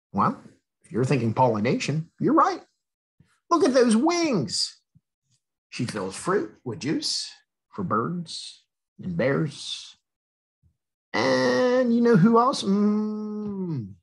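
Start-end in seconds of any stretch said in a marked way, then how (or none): 2.2-4.8 much higher excited reading voice; reading to children, delighted; enthusiastic praise. A narrator's reading. 11.1-13.9 playful tease. A narrator's reading. Teasing build-up to reveal.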